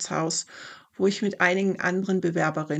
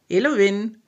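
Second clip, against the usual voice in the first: calling out; 4 semitones higher, 8 dB louder than usual. Acted. Maeve calls her friend through the window, loud call. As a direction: call a name loudly from outside.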